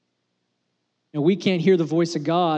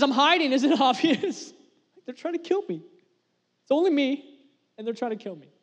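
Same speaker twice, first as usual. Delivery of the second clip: high-pitched mock protest, half laughing; exasperated Elijah; 9 semitones higher than usual. Hiding prophet answers God in the cave, exasperated. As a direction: play a hunted prophet stating the obvious, voice pitched up.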